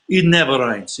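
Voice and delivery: badly faked Portuguese accent; attempted Portuguese accent. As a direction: imitate a Portuguese local, accent going astray. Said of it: "Can't do a Portuguese accent".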